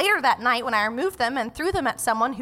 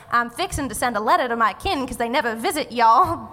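full-on Southern dialect. Shown as a demonstration. Judge demonstrates dialect to the panel, full-on Southern. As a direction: lay on a demonstration Southern accent. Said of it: "that's a full-on Southern dialect".

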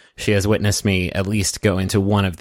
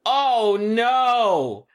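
mock alarm; 14 semitones higher than usual. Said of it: "Sound effects".

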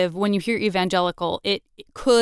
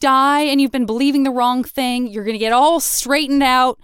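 raised, louder, emphatic promise; upbeat reassurance; 5 semitones higher, 4 dB louder than usual. Acted. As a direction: pitch it up like an eager promise of salvation. Voicing a forgiving religion's promise.

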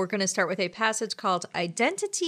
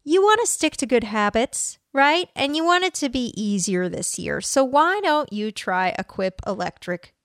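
a lightly upbeat sponsor read; 6 semitones higher than usual. Acted. Host segues to the sponsor, casual ad read. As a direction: sell the toothbrush, bright and easy.

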